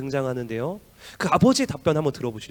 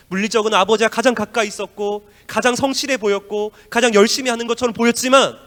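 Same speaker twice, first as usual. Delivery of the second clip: louder and higher building sermon line; preacher builds to a climax, loud; preaching at full force; 6 semitones higher, 9 dB louder than usual. Real, not acted. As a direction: drive it home to the congregation.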